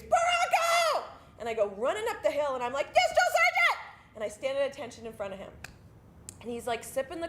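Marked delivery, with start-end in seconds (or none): 0.0-1.0 drawn-out, high-pitched yell of a name; screaming. Retold or imitated. Drill sergeant yells from top of the hill, screaming. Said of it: "And he screams". 2.9-3.8 high, loud recruit's acknowledgment; shouted reply. Retold or imitated. Recruit at attention answers drill sergeant, shouting.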